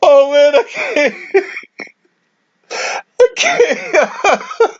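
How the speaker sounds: high, breathless words between laughs; too overcome with laughter to talk; helpless laughing. Real, not acted. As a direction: gasp out words while cracking up. Cannot stop laughing.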